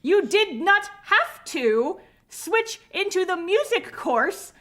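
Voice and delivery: high, slow, emphatic tirade; mock-villain rant. Ranting at the student who switched to music, mock outrage. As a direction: hammer each word in comic exasperation. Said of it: "now you're starting to sound like Shiho Lanju".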